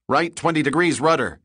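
officer calls the turn, high and loud; quick order. A narrator's reading. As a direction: snap out the helm order. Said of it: "he quickly ordered".

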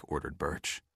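usual speaking voice

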